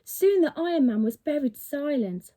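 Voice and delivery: usual speaking voice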